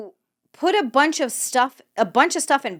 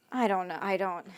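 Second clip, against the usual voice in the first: baffled murmur; 4 semitones lower, 11 dB quieter than usual. Real, not acted. Trailing off, baffled.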